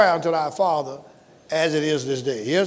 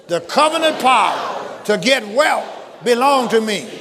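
shouted slow declaration; 6 semitones higher, 5 dB louder, slower than usual. Real, not acted. Preacher leading the church in a loud confession. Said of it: "Say it again".

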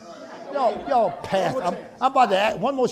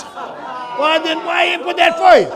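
rising preacher shout; 9 semitones higher, 8 dB louder, slower than usual. Real, not acted. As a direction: voice climbing high and loud.

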